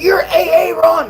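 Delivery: high, loud, drawn-out shout; imitated screaming fan. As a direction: yell it like a starstruck kid. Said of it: "screaming in the mess decks".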